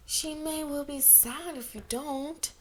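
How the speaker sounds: put-on little girl pouting to coax her teacher; mournful pout. A narrator's reading. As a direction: pout the words out sadly. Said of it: "pouted mournfully".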